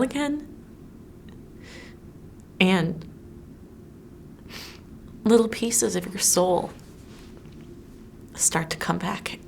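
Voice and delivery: heartbroken woman confiding, with long pauses; measured monologue, swelling in anguish then softening to a hush; lovelorn dramatic monologue. Acted. Woman telling of loving a man alone, lovelorn monologue.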